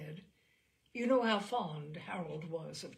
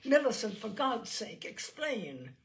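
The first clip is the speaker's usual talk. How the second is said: much louder, higher start, sinking to a demand; angry plea; 5 semitones higher, 9 dB louder than usual. Acted. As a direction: shake the truth out of her sister. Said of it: "shook her angrily".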